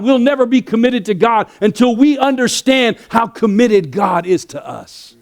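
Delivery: rising, loud preacher's build to a climax; full-force preaching. Acted. Pastor preaching to the congregation at full force. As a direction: preach it hard, pushing the congregation toward the point.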